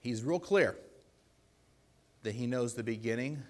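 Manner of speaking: usual speaking voice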